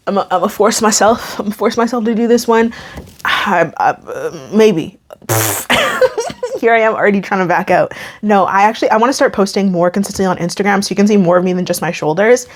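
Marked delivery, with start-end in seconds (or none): 5.3-6.5 nervous laugh. Real, not acted. Nervous about trying something new.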